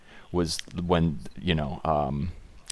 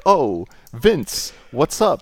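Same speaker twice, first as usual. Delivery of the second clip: hammed-up high greeting; lightly voiced sleazy producer; greeting Vince in a mock mogul voice; mock Harvey greeting; 7 semitones higher, 4 dB louder, much slower than usual. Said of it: "And then he's like".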